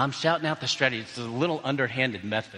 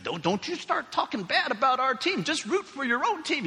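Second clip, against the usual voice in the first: mock-indignant, higher-pitched scolding; mad parent; 13 semitones higher than usual. Parent scolding a rival parent, mock mad. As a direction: imitate an annoyed parent telling someone off. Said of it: "started getting mad at him".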